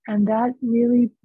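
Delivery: ordinary talk, nothing marked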